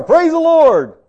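high, loud burst of praise; mock jubilation. Preacher mimics believers praising over a new car, jubilant. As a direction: whoop it out like good news just landed. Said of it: "we only say it when good things happen".

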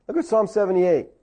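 ordinary delivery

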